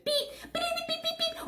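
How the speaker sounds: squeaky sing-song beeps; high mock beeping. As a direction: mimic a scanner beep, very high. Customer mocking scanner beeps.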